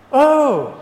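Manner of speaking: high, loud disgruntled "oh"; a loud, falling grumble of displeasure; grumbling. Acted. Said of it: "he grumbled".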